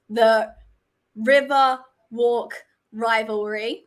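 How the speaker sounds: River Walk rivalry said slowly, word by word; slow and careful. Real, not acted. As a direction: pick slowly through a tongue twister, pausing between words. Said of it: "I'm gonna say it slow".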